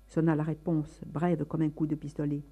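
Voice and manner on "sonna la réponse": usual speaking voice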